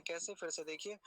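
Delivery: usual speaking voice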